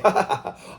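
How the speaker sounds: high-pitched put-on chuckle; mock laugh. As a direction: fake a high texted haha. Acting out his texted laugh reply.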